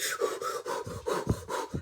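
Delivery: quick gasping breaths, imitating the cold hit; cold-shock breathing. Shown as a demonstration. Showing how cold-tub water hits you, shocked breathing. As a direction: puff out sharp breaths as if plunged in cold. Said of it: "when you hit that coldness, you're like".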